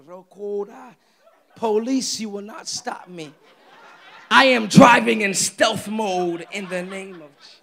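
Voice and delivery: loud hammed-up prayer declaration; mock fervent prayer. Driver praying past police on a speeding trip, mock fervent prayer.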